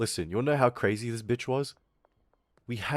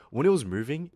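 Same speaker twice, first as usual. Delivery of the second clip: ordinary talk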